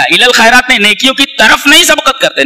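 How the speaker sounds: normal talking voice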